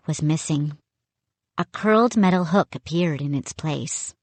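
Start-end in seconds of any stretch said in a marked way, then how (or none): none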